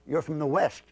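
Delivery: usual speaking voice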